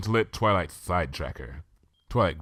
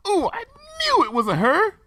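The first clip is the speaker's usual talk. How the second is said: much higher, louder squeal of triumph; excited glee; 6 dB louder than usual. Acted. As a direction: crow with delight. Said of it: "with excited glee".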